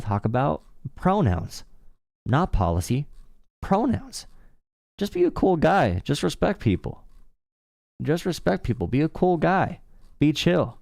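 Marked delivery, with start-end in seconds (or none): 3.6-10.8 mock voice. Mocking imagined chill guy.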